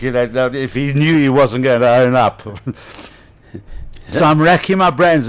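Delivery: ordinary talk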